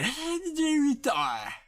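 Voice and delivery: high, swooping disbelief; mock amazement. Streamer marvelling at his eight-hour lives, high mock amazement. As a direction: swoop up high, then slide down.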